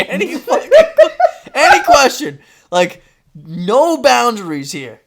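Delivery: ANY QUESTION punched high and loud, laughing; loud, high emphasis on "any", slowing at the end; emphatic disbelief. Retold or imitated. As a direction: hammer "any question", then stretch out "no boundaries here". Stressing the permission he was given.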